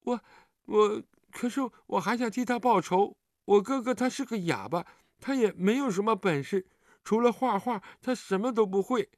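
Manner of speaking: put-on high, plaintive child voice building toward tears; aggrieved child. A narrator's reading. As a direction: plead and grieve like a wronged little kid. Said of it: "接着委委屈屈地道".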